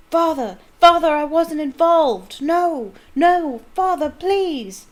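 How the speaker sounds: high, loud anguished cries; slow, screaming final plea; desperate pleading. Acted. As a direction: son begging as he is dragged off. Boy dragged away begging.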